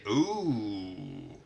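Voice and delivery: yuck ooh. Shown as a demonstration. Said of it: "And if you say".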